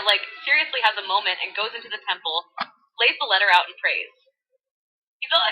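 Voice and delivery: put-on bubbly valley girl voice; valley girl retelling scripture. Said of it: "in full Valley Girl mode".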